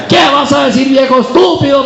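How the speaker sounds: high mock shout at the husband; mock angry wife. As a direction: bark it like a fed-up wife.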